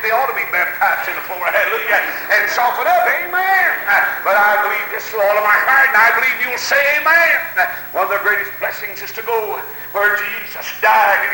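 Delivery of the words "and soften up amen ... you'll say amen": high, stretched pulpit cries; rousing revival preacher; full-force preaching. Real, not acted. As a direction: drive each phrase up into a holler.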